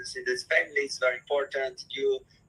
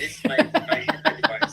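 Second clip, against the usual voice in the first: laughing; 13 semitones higher, 4 dB louder than usual. Real, not acted. Psychologist laughing while giving advice.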